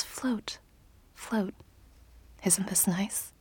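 soft breathy whisper in the ear; cupped whisper. A narrator's reading. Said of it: "A cupped whisper".

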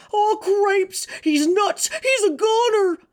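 very high shrieking mouse voice; squeaky mouse shout. Acted. Furlow panics about his brother.

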